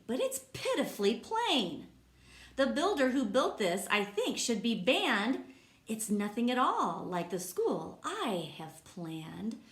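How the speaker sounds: lively, sing-song read-aloud voice; reading to children. Acted. Teacher reading a rhyming book to kids, lively. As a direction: bounce the rhymes for the class.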